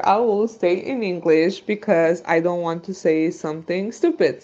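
ordinary talk, nothing marked

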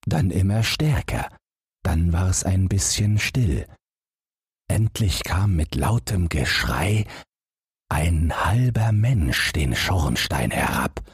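plain reading voice

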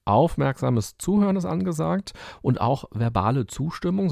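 ordinary talk, nothing marked

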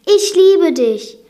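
high, loud, slow confession; impassioned, distressed cry; tearful declaration. A narrator's reading. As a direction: declare it through tears, drawn out. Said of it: "schluchzte Belle".

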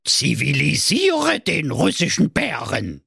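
put-on old fairy-tale witch. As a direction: lure them in like a gingerbread witch. Witch third task. (alte Hexe an der Gegensprechanlage)

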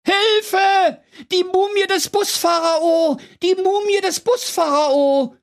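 shrill high panic scream; panicked screaming. Acted. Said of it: "in heller Angst".

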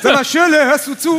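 loud, high coach shout; shouting at a player. Retold or imitated. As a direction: bark at Schürrle across the room.

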